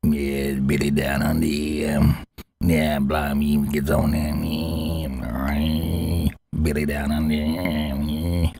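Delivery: low, slow, mumbling mock voice; imitating Billy. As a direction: mumble low like a grumpy old man. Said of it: "I gotta do it like Billy".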